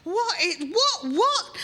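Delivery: high, loud bewildered yelps; startled. Acted. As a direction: jump at each word in alarm. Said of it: "and they go".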